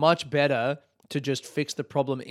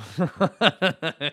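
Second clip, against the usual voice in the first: short real laugh; 4 semitones higher, 6 dB louder than usual. Real, not acted. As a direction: laugh at your own joke. Laughs at own joke.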